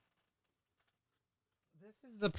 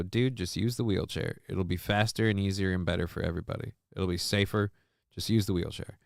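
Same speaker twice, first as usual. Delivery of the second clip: hushed aside to the husband, lower and softer; leaning in, quiet; 5 semitones lower, 8 dB quieter than usual. Retold or imitated. Husband gets a quiet aside, out of the wife's earshot, hushed. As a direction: murmur it close so his wife can't hear. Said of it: "I lean in close so she can't hear".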